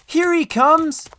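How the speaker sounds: very high, loud, slow shout. A narrator's reading. Little boy spotting the guard, shouting high and loud. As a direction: yell it across the station. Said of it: "a little boy shouted".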